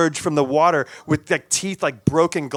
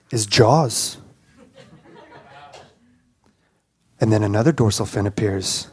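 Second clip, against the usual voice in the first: suspenseful narration; 6 semitones lower than usual. Retold or imitated. Storyteller revealing sharks circling the boat, suspenseful narration. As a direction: draw out the reveal, deadpan and ominous.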